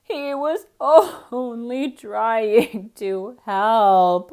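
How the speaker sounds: loud, slow, wailing cry of a cat; weeping cat. A narrator's reading. Cat defending the missing duck, weeping. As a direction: weep it out, stretched and loud. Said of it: "wept the cat".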